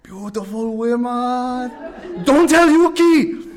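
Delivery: put-on high, slow accented words, loud at the end; gleeful secret; imitating Toshi. Toshi tempted by the invitation.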